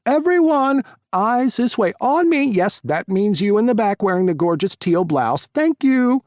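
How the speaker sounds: high, loud showman calling the crowd; loud announcer voice. Acted. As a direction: work the room like a host. Tyler's cafe announcement.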